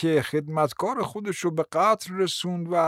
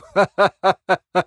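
loud open laugh; burst of laughter; 6 dB louder than usual. Acted. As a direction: laugh out loud at the absurd idea. Man laughs at the talk.

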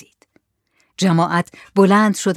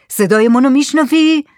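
raised, louder call to someone silent; shouted question; 7 semitones higher, 6 dB louder than usual. Acted. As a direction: shout to check you are heard. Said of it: "دوباره داد زد". (با داد پرسیدن)

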